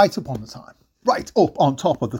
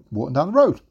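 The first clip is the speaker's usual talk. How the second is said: ordinary delivery, nothing marked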